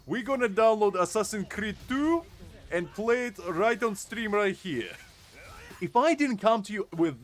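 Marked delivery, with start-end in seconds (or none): 0.1-4.9 high, loud mock Russian streamer intro; thick Russian accent. Streamer posing as Russian tutorial maker, thick Russian accent. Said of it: "having a very thick Russian accent".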